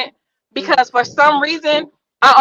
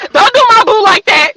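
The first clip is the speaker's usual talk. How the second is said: laughing protest; 8 semitones higher, 6 dB louder than usual. Real, not acted. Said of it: "She had two eleven".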